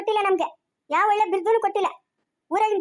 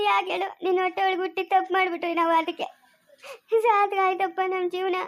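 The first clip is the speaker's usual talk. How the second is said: sobbing between phrases; crying. Acted. Hurt child reproaching the parent who shamed the family, crying. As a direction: lament through tears, catching the breath. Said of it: "ಯಾಕ್ ಅಳ್ತಾ ಇದ್ಯಾ?".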